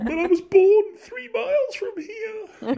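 high, drawn-out mock protest; exaggerated protest. Returning local answers the bartender, exaggerated high protest. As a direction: protest in a squeaky, slow whine. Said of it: "and I was like".

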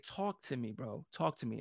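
soft, calm talk-down; gentle officer soothing an angry suspect. Retold or imitated. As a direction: quietly coax him to open up.

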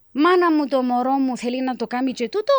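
high, gushing overprotective mum; doting mother voice. Retold or imitated. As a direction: gush like a fussing mother. Imitating doting Cypriot mother.

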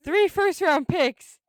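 stunned and emphatic; shocked. Retold or imitated. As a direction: stretch it out in loud shock at the price. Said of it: "It really shocked me at first".

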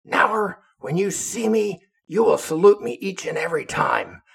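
high, loud angry officer's order; angry captain. Retold or imitated. Short captain angrily ordering the sailor to salute, loud and high. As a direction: bark it like a furious little captain. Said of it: "he's really pissed off".

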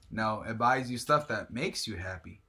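ordinary talk

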